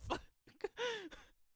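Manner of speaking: sudden high squeal of laughter. Real, not acted. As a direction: burst into a shrill cackle. Laughs after own rant.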